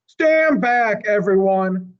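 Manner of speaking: high, loud yell. Acted. Mac shouts at everyone to stand back, loud yell. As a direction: bark it out to clear the area. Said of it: "Mac yells".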